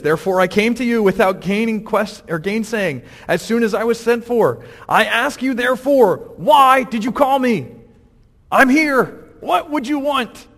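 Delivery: forceful acted apostle's address; declamatory preaching. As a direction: declaim it, building to a demanding question. Preacher voices Peter's speech from scripture.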